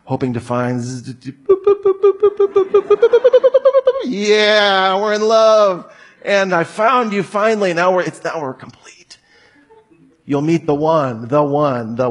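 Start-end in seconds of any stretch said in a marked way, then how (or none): 1.1-4.0 very high electronic boops, rising as they go; squeaky high beeping like a radar; love radar beeping. Retold or imitated. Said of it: "with some kind of a love radar". 4.0-8.9 mock lovestruck. Mock lovestruck couple, right after radar.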